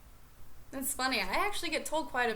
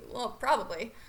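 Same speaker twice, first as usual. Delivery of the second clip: normal talking voice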